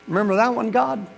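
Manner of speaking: high, loud plea; plea to God to remember his promise; preaching. Acted. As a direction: call it out to heaven.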